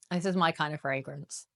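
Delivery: usual speaking voice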